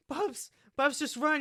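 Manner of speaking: high loud cry to flee; desperate shout. Acted. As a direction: yell at Bubs to run. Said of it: "cries out".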